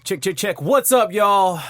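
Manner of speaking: high, loud hype opener; hyped intro. Real, not acted. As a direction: pump up the crowd. Hyped show opening.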